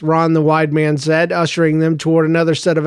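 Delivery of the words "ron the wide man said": usual speaking voice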